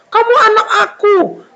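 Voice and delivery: high-pitched, heartfelt acted exclamation; emotional insistence. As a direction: insist like an overjoyed father. Father repeats to son.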